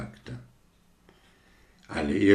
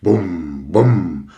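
loud, booming firework sound; booming bum; 6 dB louder than usual. Acted. Roman candle booms over the garden, loud booming. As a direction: let the candle boom out. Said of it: "dröhnte die römische Kerze".

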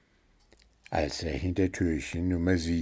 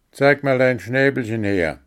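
loud, insistent little gnome; the gnome demands a look at the bird's beak, loud and bossy; demanding; 5 semitones higher, 6 dB louder than usual. A narrator's reading. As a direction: order the bird to show his beak. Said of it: "forderte ihn Puh auf".

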